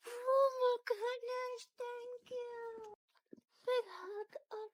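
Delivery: slow, teary and overjoyed; moved to near tears by the hug; touched cry. Real, not acted. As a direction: gush with emotion at the hug. The pet gives her a hug.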